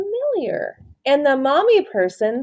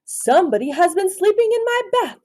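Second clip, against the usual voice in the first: raised, lively father's line; daddy person voice; 11 semitones higher than usual. A narrator's reading. Teacher voicing the daddy person to the class, mildly indignant. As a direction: read the dad's complaint with a lift. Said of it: "said the daddy person".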